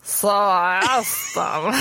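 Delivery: put-on slow, slurred drunk voice; hammed-up tipsy drawl; a little drunk. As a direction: slur it like you're tipsy. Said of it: "as if you're a little drunk".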